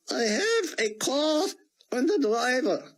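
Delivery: put-on the old rabbi announcing "a car and a driver" in thick Hebrew-accented English. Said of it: "Buck fought to understand him".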